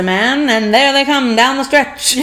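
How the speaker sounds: lightly voiced vintage race caller; old-time radio announcer, half laughing; old-timey announcer. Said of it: "The old-timey announcer".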